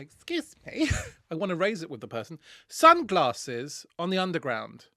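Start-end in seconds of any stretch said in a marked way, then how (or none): 0.0-0.9 prim middle-aged woman. Retold or imitated. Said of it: "middle-aged woman where I want to say".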